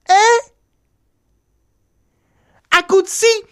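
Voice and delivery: high, loud incredulous "eh?"; sharp rise of astonishment; shocked. Acted. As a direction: blurt it out in disbelief. Shocked at the boy's request.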